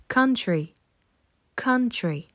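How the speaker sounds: "Country" with a short vowel between a and o; model pronunciation. Shown as a demonstration. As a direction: say "Country" as kan-tri, neither ou nor ao. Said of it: "Ce son est à mi-chemin entre un A et un O". (prononcer "Country" avec un son entre A et O)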